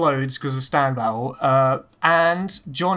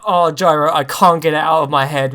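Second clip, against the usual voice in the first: louder, strained forced enthusiasm; lightly voiced pained compliment; pained fake praise; 8 dB louder than usual. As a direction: oversell it like it hurts. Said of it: "he looks like he's in pain".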